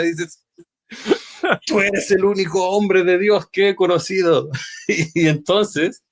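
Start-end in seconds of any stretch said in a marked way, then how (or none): 1.7-4.5 high, emphatic confession; husband's earnest outburst. Retold or imitated. Husband confides in the preacher, high and emphatic. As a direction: pour it out with relief.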